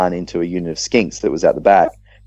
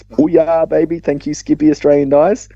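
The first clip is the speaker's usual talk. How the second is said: much higher, exultant cheer over the dice; triumphant cheer; 9 semitones higher than usual. Retold or imitated. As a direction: crow with delight at the lucky roll. Celebrating the winning dice roll.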